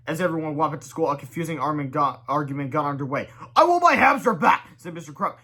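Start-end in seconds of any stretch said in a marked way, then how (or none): 3.6-4.7 loud, high, bossy demand; high shouted demand. Acted. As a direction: insist like a grumpy principal. Principal demands hamster back.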